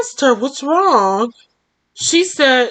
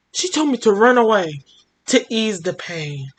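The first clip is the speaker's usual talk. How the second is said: usual speaking voice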